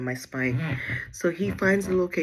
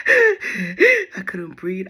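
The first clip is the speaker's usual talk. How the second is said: half-reenacted panic, gasping and loud; gasp, then push the words out in retold panic; panicky gasp; 3 semitones higher, 12 dB louder, much slower than usual. Retold panic attack, crying.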